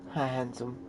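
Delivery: ordinary delivery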